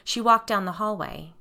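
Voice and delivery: ordinary delivery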